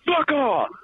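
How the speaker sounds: high snapped "Fuck off!"; mock yell. Recruit yelling back at a fellow recruit, mock shout. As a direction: bark it back like an order. Mishearing "sound off" as reply.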